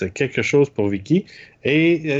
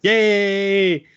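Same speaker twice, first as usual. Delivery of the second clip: excited cheer; 7 semitones higher, much slower than usual. Real, not acted. Cheering for Vicky.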